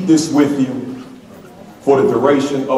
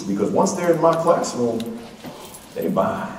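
normal talking voice

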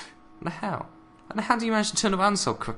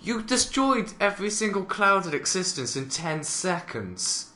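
usual speaking voice

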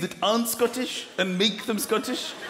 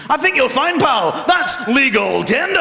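Scottish indignation; 5 semitones higher, 7 dB louder than usual. Retold or imitated. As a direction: lay down the law in Scottish. Scot's stock indignant phrase.

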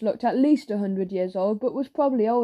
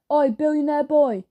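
raised heckle from the back; calling out; 5 semitones higher, 5 dB louder than usual. A narrator's reading. Older boy calls to Joe from the back, heckling shout. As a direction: call out louder and higher, heckling. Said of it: "came a deep voice from the back".

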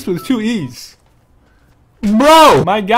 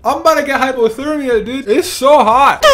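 normal talking voice

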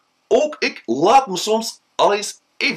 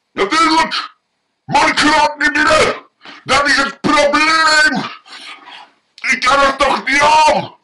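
much higher, louder exasperated whine; exasperated drunk; 8 semitones higher, 4 dB louder than usual. Acted. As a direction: complain that you cannot get in. Drunk man explains problem.

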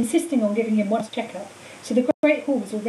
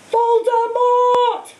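high, long-drawn loud bellow; 13 semitones higher, 7 dB louder, much slower than usual. A narrator's reading. Harry shocking Hagrid out of crying, bellowing. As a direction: bellow it out, stretching every syllable. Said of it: "Harry bellowed".

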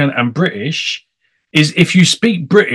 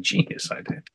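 "Genius idea" whispered, much softer; whispered aside; 12 dB quieter than usual. Real, not acted. Host repeating praise to himself.

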